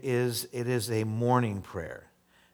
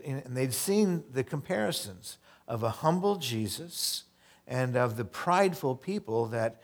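ordinary talk, nothing marked

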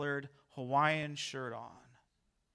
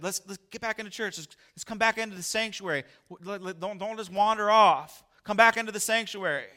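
higher, insistent coaxing; urgent pleading; 6 semitones higher, 7 dB louder than usual. Retold or imitated. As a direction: beg them back inside. Pastor pleading couple to return.

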